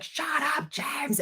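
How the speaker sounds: very high mock shriek, kept soft; tiny squeaky imitation of a shriek; imitated escalating scream. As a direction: squeal it as a pretend scream without the volume. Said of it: "screaming in my face louder and louder".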